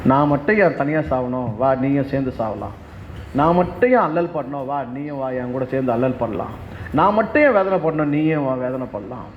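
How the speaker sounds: low, monotone mock lament; voiced worrier. A worrier inviting someone to suffer along, low mock lament. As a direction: put on a low-pitched sufferer asking for company.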